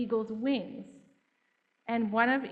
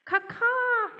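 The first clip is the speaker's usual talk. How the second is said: high, loud screaming caw-caw; crow caw; 13 semitones higher, 3 dB louder than usual. Shown as a demonstration. Storyteller shows the children the crow's scream. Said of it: "Can you say caw-caw".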